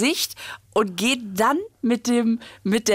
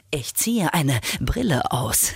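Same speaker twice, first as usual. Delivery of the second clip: slow, lower, grandly cool; dramatic; 6 semitones lower, slower than usual. Shown as a demonstration. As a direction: say it like a film hero. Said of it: "ich zieh die Brille aus".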